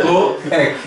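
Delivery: laughing through coec, pitched high. Real, not acted. Teacher laughs saying coec, sounds like cueca. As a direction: giggle at the joke while saying coec. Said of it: "Ó, cueca".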